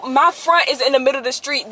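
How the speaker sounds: usual speaking voice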